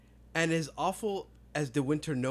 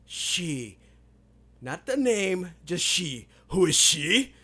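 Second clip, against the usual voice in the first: loud, higher, demanding questioning; super intense; 5 semitones higher, 9 dB louder, much slower than usual. Acted. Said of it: "super intense all of a sudden".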